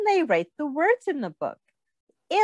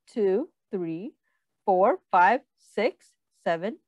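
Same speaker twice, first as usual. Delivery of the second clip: ordinary delivery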